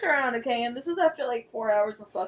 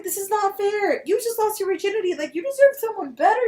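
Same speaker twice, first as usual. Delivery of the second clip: raised, pitched-up retold protest; high protesting; 9 semitones higher, 4 dB louder than usual. Retelling her protest to the virgin guy, high-pitched. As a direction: plead it high and urgent.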